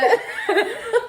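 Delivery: high loud laugh; burst of laughter. Real, not acted. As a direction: laugh out loud, bright and sudden. Laughing at the bong joke.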